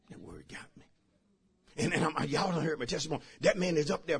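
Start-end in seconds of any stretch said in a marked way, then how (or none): 0.1-0.8 whispered, drawn inward; hushed whispered repeat. Real, not acted. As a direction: breathe it as a confession.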